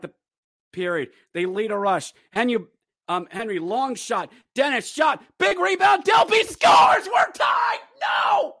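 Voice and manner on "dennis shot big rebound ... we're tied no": very high, loud despairing yell; screaming fan despair; anguished shout. Real, not acted. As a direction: scream at the tying goal. Fan screaming at tying goal.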